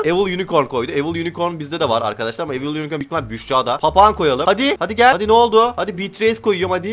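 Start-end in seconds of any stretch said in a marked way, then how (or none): 4.5-6.9 high, loud taunting urge; excited goading. Real, not acted. Player daring a rival to keep trading, loud and excited. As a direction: egg them on, pitched right up.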